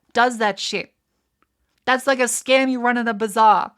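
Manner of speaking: loud, heated tirade at the price; furious rant. Real, not acted. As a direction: keep shouting your outrage. Ranting about price, loud throughout.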